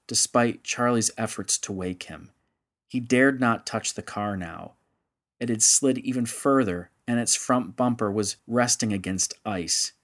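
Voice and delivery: ordinary delivery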